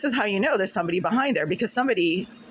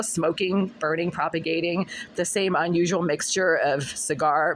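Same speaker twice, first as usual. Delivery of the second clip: ordinary talk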